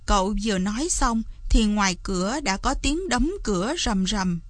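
ordinary delivery

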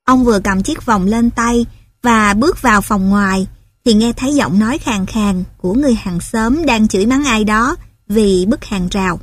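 plain reading voice

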